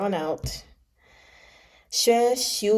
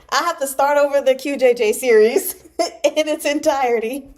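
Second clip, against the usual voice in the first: laughing dismay; 5 semitones higher, 6 dB louder than usual. Real, not acted. Dismayed at own mistake, laughing.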